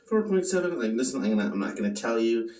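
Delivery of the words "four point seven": Four point seven said higher and brighter; retake, pitched up. Shown as a demonstration. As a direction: announce it fresh on the second take. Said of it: "Take two".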